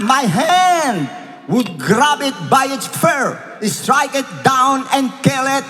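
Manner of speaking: fervent preacher reading; preaching at full force. Acted. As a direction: proclaim it like a battle cry.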